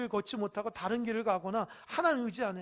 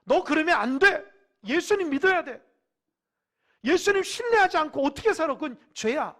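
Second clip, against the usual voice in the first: higher, far louder, stern calling out; Spirit's rebuke; 7 semitones higher, 16 dB louder than usual. Acted. Holy Spirit confronting an unbeliever, loud stern shout. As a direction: shout the rebuke like a warning to a wayward child. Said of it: "성령님이".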